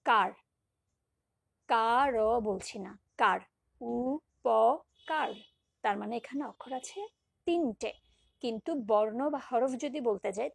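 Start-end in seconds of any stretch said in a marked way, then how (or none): 3.8-5.3 উপকার drawn out very slowly and louder; stretched syllables. Shown as a demonstration. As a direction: stretch উপকার as far as the breath goes. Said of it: "যতদূর পারছি টেনে নিয়ে যাচ্ছি". (টেনে টেনে উচ্চারণ)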